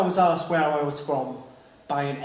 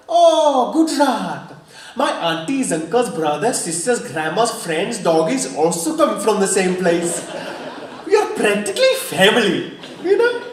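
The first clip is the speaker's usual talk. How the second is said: higher, louder, hammed-up Indian accent, laughing; mock Indian accent; 4 semitones higher, 6 dB louder than usual. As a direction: gush about family in a put-on Indian accent. Mock answer to the driver.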